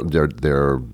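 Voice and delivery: ordinary delivery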